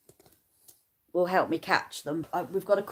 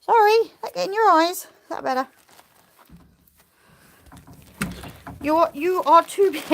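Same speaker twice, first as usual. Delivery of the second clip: pet talk; 9 semitones higher, 4 dB louder than usual. Real, not acted. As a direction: fuss over the animal. Talking to the animal.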